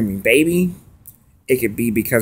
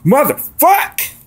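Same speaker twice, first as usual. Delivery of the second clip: higher, louder, slow drawn-out mock outburst; imitating a sudden snap, higher and louder; mock snapping curse; 12 semitones higher, 4 dB louder, much slower than usual. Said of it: "I'm just like".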